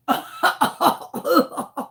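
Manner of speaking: long breathy laughing fit; helpless laugh. Real, not acted. Teller cracking up after retelling roach horror, helpless. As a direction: crack up at your own story. Laughing at her own story.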